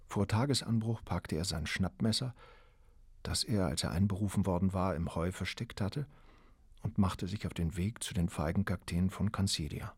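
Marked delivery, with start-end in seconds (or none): none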